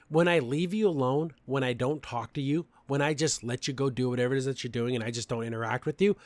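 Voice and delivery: usual speaking voice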